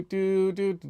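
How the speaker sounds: ordinary delivery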